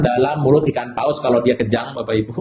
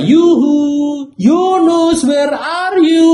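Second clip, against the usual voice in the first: much higher, louder sing-song call, slow at the end; playful yoo-hoo searching for Jonah; calling whale; 11 semitones higher, 5 dB louder, much slower than usual. Acted. Whale circling, calling Jonah loud and high. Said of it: "Ikan paus muter-muter dan berkata".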